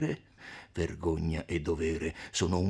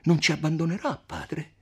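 a touch of tearful pleading, higher; mild sobbing; 8 semitones higher, 4 dB louder than usual. A narrator's reading. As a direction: beg him, holding back sobs.

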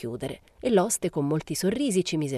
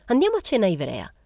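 higher pitched, with a relieved lift; a touch of relief, pitched up; 6 semitones higher, 4 dB louder than usual. A narrator's reading. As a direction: propose it as if relieved. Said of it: "disse Momina sollevata".

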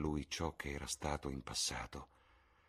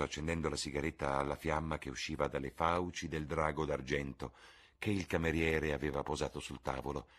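ordinary delivery, nothing marked

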